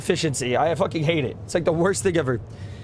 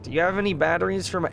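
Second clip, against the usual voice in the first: ordinary delivery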